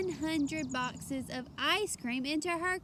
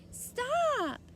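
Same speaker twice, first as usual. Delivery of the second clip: high, loud yell; 13 semitones higher, 5 dB louder than usual. A narrator's reading. Taya yells at the lady as loud as she can. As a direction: yell with all her might. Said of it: "yelled as loudly as she could".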